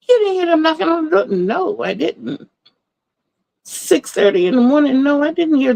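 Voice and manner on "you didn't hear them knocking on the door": high, fast daughter voice; imitating daughter. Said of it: "She's like".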